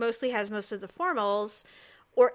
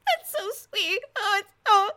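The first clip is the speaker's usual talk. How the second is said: high, wobbly, half-sobbing gush; hammed-up teary over a sweet backstory; mock crying; 11 semitones higher, slower than usual. Watching Chopped, she gets choked up at a contestant's story, mock sobbing. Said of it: "And I'm like".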